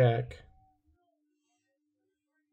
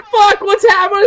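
mock panicked yell; 10 dB louder than usual. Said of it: "I was like".

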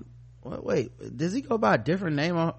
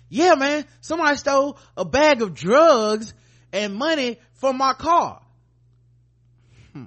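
higher and louder, outraged caller; indignant drug dealer; 6 semitones higher, 4 dB louder than usual. Retold or imitated. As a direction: demand the cops find your drugs. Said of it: "the police are like".

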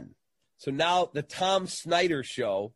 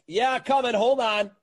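higher and louder, calling through the van door; 8 semitones higher, 5 dB louder than usual. Retold or imitated. Host answers a knock on the van door, calling out. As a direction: call it out to whoever is knocking.

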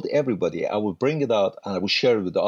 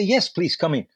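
eager welcome; 6 semitones higher than usual. Retold or imitated. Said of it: "I was so excited".

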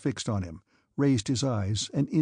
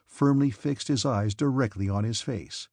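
ordinary delivery

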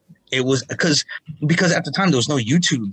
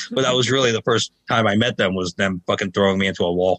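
ordinary talk, nothing marked

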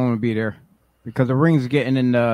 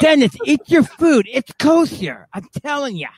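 Yonkers mom; 10 semitones higher, 3 dB louder than usual. Pretend. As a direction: nag your son about food.

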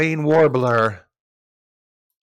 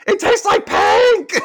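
very high, loud, drawn-out squeal; drunken yell; 8 dB louder, slower than usual. Retold or imitated. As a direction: shriek it slowly like a giddy drunk. Quotes drunk Rachel, then yells it.

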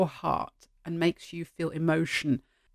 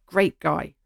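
usual speaking voice

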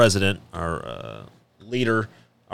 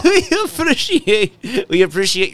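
laughing mock thanks; 9 semitones higher than usual. Laughing, high hammed sponsor thanks.

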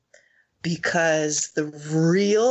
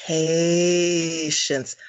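long drawn-out, deliberate word; slow for emphasis. Real, not acted. Driving her lesson home to a friend, very slow for emphasis. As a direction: stretch out the first syllable for emphasis. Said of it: "I deliberately say that slowly for emphasis".

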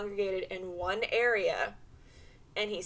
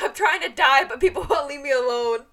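exasperated whine; 4 semitones higher, 9 dB louder, faster than usual. Retold or imitated. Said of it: "It's like".

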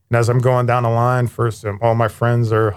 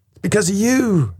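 put-on childish blaming whine; 9 semitones higher than usual. Child blaming his mom.

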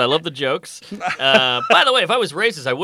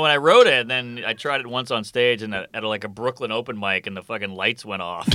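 ordinary talk, nothing marked

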